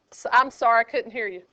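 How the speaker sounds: ordinary delivery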